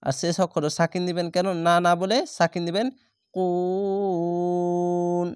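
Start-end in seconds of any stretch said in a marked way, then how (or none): 3.3-5.3 কুন held long in chant, three beats; long stop madd. Shown as a demonstration. Teacher shows students the long stop on কুন. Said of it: "তিন আলিফ টেনে থামবেন".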